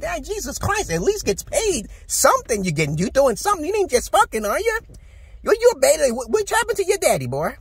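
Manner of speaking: mock the guy in a squeaky high register; sarcastic high-pitched ribbing. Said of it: "talking like this".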